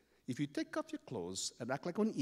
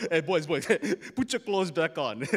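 loud words through big laughter; acted amused big man; laughing; 3 semitones higher, 7 dB louder than usual. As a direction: chuckle heartily as you talk. Amused neighbour laughs at the boys.

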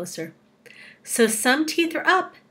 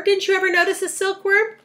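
very high, louder excited question; crying out; 11 semitones higher, 6 dB louder than usual. A narrator's reading. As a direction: ask it in eager storybook excitement. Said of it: "cried the old green grasshopper".

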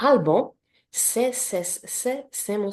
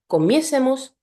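comiésemos with MIÉ pitched up and stressed; stressed stem vowel; 6 semitones higher, 4 dB louder than usual. Shown as a demonstration. Teacher showing where nosotros takes its accent, comiésemos stressed on MIÉ. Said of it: "akcent będzie zawsze".

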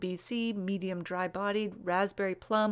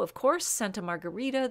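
usual speaking voice

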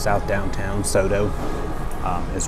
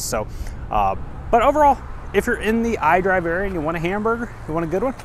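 higher, upbeat host wrap-up; vlog outro; 7 semitones higher than usual. Acted. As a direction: sell it to the viewers.

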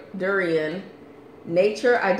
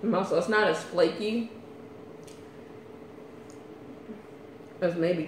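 speaking through hand over mouth; talking while chewing; 3 semitones lower, 4 dB quieter than usual. Real, not acted. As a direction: mouth partly full of food. Seen 0: Speaker unsure; woman seems to chew, hand covering mouth while talking.

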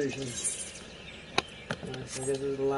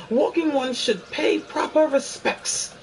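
slow, lofty fairy demanding respect; haughty fairy, drawn out; high, slow fairy voice; 10 semitones higher, 4 dB louder, slower than usual. Acted. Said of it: "my best fairy voice".

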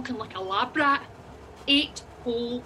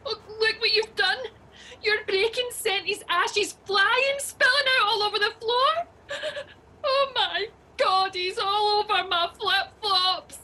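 crying; 7 semitones higher than usual. Acted. Upset passenger blaming driver for spilt ashes, crying. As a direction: wail through the tears in mounting horror. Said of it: "Stop crying".

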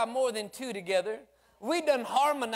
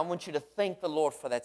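ordinary talk, nothing marked

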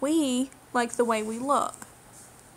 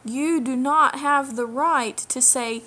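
normal talking voice

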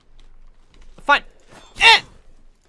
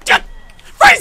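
loud high outraged yell; yelling a name; 15 dB louder than usual. Real, not acted. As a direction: shout his name in protest. Teammate steals his kill.

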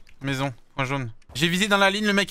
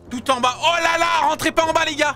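excited warning; 3 dB louder than usual. Real, not acted. Warning squad mid-fight.

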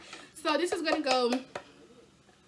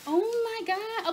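drawn-out, high Oh my God; excited gasp; 9 semitones higher, 4 dB louder, slower than usual. Real, not acted. To viewers, thrilled about the kitchen haul, drawn-out excited cry. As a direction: squeal it slowly, thrilled.

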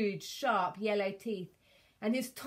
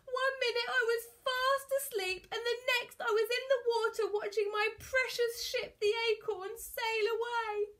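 high, tearful pirate lament; hammed-up sobbing voice; 3 dB louder than usual. A narrator's reading. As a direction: keep wailing through the tale. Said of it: "he sobbed".

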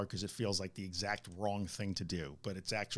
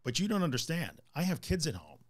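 higher-pitched, pleading pushback; protesting fearful parent; 5 semitones higher than usual. Acted. Client arguing with therapists for the fear, pleading protest. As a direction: plead the case as a worried parent. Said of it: "one of the most common arguments I hear in favor of the fear".

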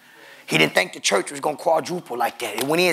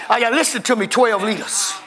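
higher, calling out to the church; lively preaching; 8 semitones higher than usual. Acted. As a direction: rouse the congregation.

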